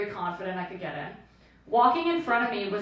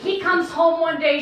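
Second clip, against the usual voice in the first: ordinary talk, nothing marked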